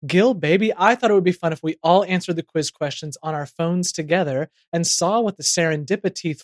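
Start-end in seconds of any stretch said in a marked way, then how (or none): none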